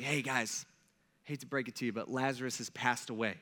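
soft, low and considerate; kindly euphemism, quietly put; gentle news-breaking. Acted. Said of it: "trying to say it in a nice way".

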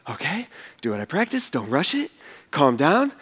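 low, soft pep talk to himself; hushed self-coaching, reining in the excitement; catching himself. Acted. Angel steadying himself before he speaks, catching himself. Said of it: "he kinda catches himself".

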